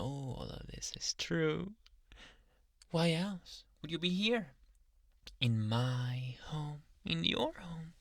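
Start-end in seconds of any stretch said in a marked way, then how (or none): none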